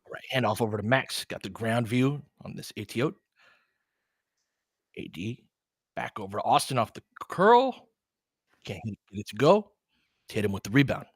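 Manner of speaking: quick running game call, rising on big plays; casual sports commentator calling each possession; live play-by-play. Acted.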